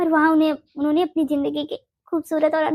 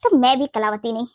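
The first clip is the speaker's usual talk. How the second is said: ordinary talk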